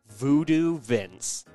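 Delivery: usual speaking voice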